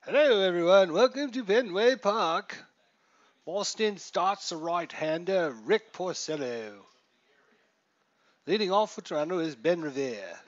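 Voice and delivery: a hammed-up, loud, high Boston announcer voice; bad Boston accent. Host welcoming viewers to Fenway in a mock Boston accent. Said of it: "That's a pretty terrible Boston accent".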